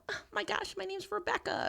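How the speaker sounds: high, bubbly put-on voice. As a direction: play a perky Rebecca. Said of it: "you know".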